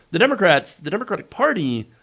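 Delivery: ordinary delivery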